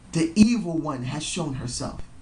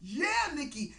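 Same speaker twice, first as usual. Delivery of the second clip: yelling cheer; 13 semitones higher, slower than usual. Retold or imitated. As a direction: re-enact an excited fan's yell, then let it drop away. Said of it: "because I was yelling, saying".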